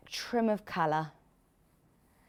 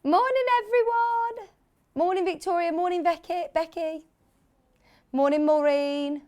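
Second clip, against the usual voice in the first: high, sing-song hellos; high-pitched morning roll-call; sing-song greeting; 9 semitones higher than usual. Real, not acted. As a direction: greet each one on a high, held note.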